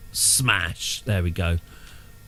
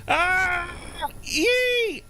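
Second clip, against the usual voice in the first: sudden shrill yelp of alarm; high squealing yelp. Real, not acted. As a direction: squeal in surprise. Plane takes off again.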